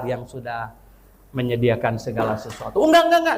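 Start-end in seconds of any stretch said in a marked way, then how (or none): 2.8-3.4 emphatic refusal. Retold or imitated. Refusing the churches' offers.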